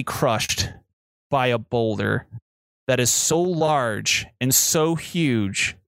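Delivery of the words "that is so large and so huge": normal talking voice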